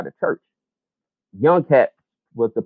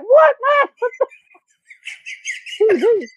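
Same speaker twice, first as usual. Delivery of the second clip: high squeak of disbelief with a laugh; mock outraged disbelief; incredulous; slower than usual. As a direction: act stunned, half laughing. Retold reaction to changed lyric.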